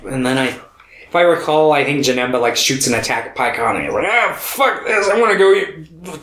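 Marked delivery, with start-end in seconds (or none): 4.1-6.2 mock fed up. Voicing fed-up character.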